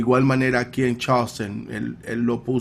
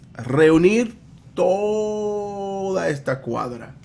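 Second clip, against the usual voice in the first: "toda" drawn way out, pitched high; stretched-out word; 6 semitones higher, much slower than usual. Retold or imitated. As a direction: stretch TODA slow and high over the whole block. Acted request, stretched "toda. ("toda" alargado, lento y agudo)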